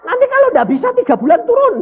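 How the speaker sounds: high, loud threat; imitated strident warning; shouted warning. Acted threat of demotion.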